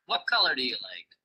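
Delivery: quick question sliding down to "like"; falling question intonation. Shown as a demonstration. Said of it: "The sound also falls with questions".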